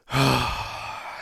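long deflated sigh before answering; weary sigh. Retold or imitated. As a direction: let all the air out, bored to death. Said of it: "That exact tone".

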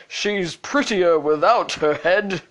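slow, put-on princely voice; lightly voiced, self-satisfied prince; smiling prince. A narrator's reading. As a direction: say it slowly with a smile. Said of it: "He smiled and said".

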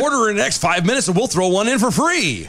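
high, laughing salesman pitch; infomercial pitch. Pretend. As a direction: sell it like a TV ad.

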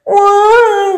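put-on the baby yelling back at his sister, loud yelp; startled yell. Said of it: "gritou o bebê".

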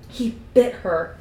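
slow, weighted disbelief; appalled. A narrator's reading. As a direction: draw it out, appalled. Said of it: "It was appalled".

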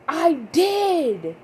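put-on high, loud snappy teen retort; bratty daughter. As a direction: snap it back like a sassy kid. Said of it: "she was like".

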